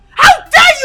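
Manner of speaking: high, loud indignation; outraged yelling. Acted. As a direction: yell it as an affronted princess. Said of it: "[name], you are yelling".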